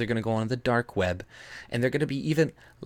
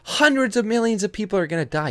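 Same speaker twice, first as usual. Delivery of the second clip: high, loud hysterical mock wail; mock alarmist; 11 semitones higher, 6 dB louder, faster than usual. Vlogger parodying climate alarmists, in a loud hysterical mock shriek. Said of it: "they're like".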